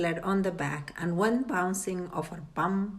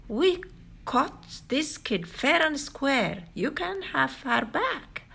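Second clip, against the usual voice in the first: fish voice; 7 semitones higher than usual. A narrator's reading. Big fish voiced in story.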